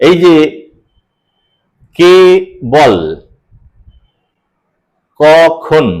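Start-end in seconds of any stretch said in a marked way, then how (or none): 2.0-3.0 "কে" held, a gap, then "বল"; syllable split. Shown as a demonstration. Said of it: "শব্দগুলো উচ্চারণ কিভাবে হচ্ছে". 5.2-5.9 "কখন" as a long "ko", then "khon"; syllable split. Shown as a demonstration. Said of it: "কখন যখন বলি".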